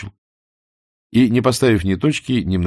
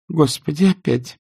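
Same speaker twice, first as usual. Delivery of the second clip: horrified; 8 semitones higher than usual. A narrator's reading. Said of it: "ужаснулась Аза".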